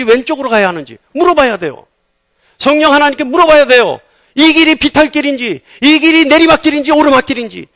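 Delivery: preaching. Acted. Pastor urging the congregation to ask God, fervent preaching. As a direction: drive the point home to the congregation.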